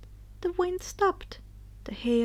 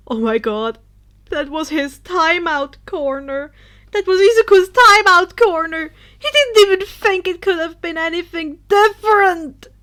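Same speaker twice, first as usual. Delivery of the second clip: much louder and higher disbelief; horrified realization; 4 semitones higher, 12 dB louder than usual. A narrator's reading. Narrator as Denki realizing the timeout corner, horrified. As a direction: let the horror dawn out loud.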